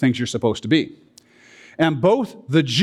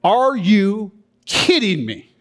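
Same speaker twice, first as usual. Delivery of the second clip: slow, higher-pitched incredulous retort; emphatic disbelief; 5 semitones higher, much slower than usual. Acted. Preacher paraphrasing Paul's "certainly not" to listeners, emphatically incredulous. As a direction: stretch it out as an emphatic comeback. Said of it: "that's an emphatic".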